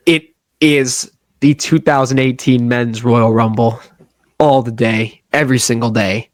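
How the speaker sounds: announcer voice. Acted. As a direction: sell it like a deep-voiced promo. Grand low pitch for his pick.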